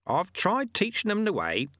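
proud. A narrator's reading. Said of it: "said Lutze proudly".

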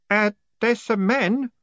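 put-on high, hesitant boy voice; uncertain boy voice. A narrator's reading. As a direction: say it shyly, unsure. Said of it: "grinning uncertainly".

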